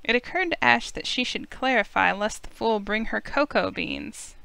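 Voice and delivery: ordinary delivery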